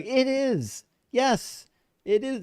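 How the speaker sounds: high mock-solemn agreement; high playful voice. Host agreeing with a viewer's joke in a high voice. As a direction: lift the voice high and play along.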